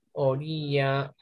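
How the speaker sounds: ordinary talk, nothing marked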